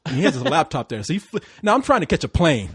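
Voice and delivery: usual speaking voice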